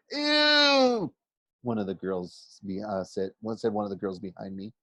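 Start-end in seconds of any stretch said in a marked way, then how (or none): 0.1-1.1 high, loud grossed-out "Ew!"; disgusted squeal. Acted. Schoolgirl hearing about barfed-up gods, squealing. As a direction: shriek of disgust. Girl reacts to gross myth.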